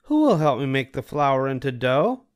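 a hen politely asking a neighbour; Little Red Hen. A narrator's reading. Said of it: "she asked".

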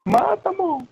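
grito bem agudo de incentivo. Real, not acted. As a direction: grite para o parceiro matar. Gritando no jogo. (grito agudo no jogo)

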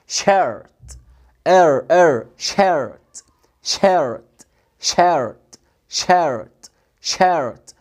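"شيرت" low and falling, with a full English R; careful English R. Shown as a demonstration. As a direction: give the R in "شيرت" full weight, no long "ay". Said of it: "لازم تديله اهتمام".

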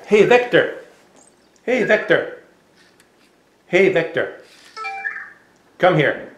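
high, insistent calls to a robot; repeated calling. Real, not acted.

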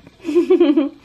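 high bright burst of laughter; delighted laugh. Real, not acted. Laughing at grandbaby.

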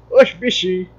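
light imitation Baiano accent; mock Baiano accent. Vlogger joking about his Baiano roots, mock Baiano accent. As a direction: toss it out in a playful Baiano accent. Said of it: "eu imito baiano".

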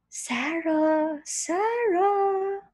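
eerie rising call of a name; soft echoing dream voice. Acted. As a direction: call her name like a spooky whisper. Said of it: "whispering the name of Sarah, it can be parang scary".

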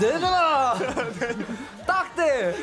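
high, loud taunt; hype shout. Pretend. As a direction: challenge the new year. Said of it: "노래 틀어주세요".

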